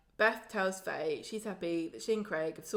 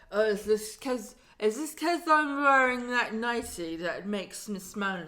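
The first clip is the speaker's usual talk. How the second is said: slow, louder put-on voice; mock drunk pleading; 3 semitones higher, 6 dB louder, slower than usual. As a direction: plead in a hammed-up tipsy voice. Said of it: "she's like".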